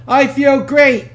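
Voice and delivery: loud, high, stretched-out affirmation; emphatic motivational chant; like you mean it. Shown as a demonstration. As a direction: declare it slowly with full conviction. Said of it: "say this like you mean it".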